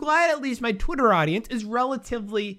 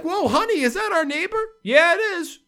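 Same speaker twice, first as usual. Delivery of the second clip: higher, surprised mock chatter between spouses; spouse spotting neighbor; 10 semitones higher than usual. Couple watching preseason recognise their neighbor, higher, mock surprised. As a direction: play a couple gawking at the TV. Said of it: "You're like".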